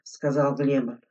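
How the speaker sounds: ordinary delivery, nothing marked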